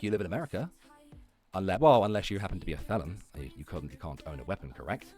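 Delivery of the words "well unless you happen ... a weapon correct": ordinary delivery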